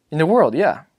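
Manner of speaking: put-on young son, insistent; a child's firm reply; boy confirming. Boy's voice.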